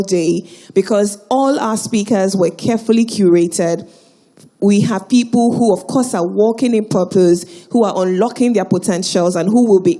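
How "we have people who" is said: usual speaking voice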